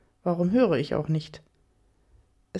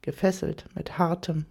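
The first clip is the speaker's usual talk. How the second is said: ordinary delivery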